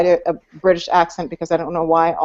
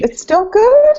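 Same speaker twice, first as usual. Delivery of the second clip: surprised; 6 dB louder, slower than usual. Real, not acted. Surprised someone still likes it.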